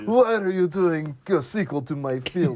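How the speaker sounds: slow Russian accent impression. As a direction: lightly mimic a Russian director. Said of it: "in his Russian accent".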